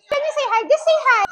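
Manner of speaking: high, sing-song coaxing. Real, not acted. Asking a shy toddler to greet, coaxing high.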